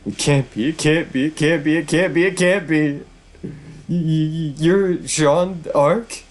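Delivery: high, frantic stammering bishop; terrified, high-voiced denial; panicked bishop in disbelief.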